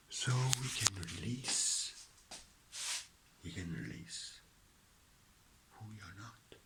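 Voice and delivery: hushed letting go; whispered release. Real, not acted. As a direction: let it go on a breath.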